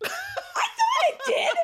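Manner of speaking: high, slow laughing reply. Real, not acted. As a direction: laugh it out, amused. Laughs, was right about lights.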